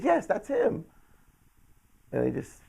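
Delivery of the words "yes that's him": very high, urgent yes, imitated; emphatic confirmation. Mom confirms magazine man to son, high and emphatic.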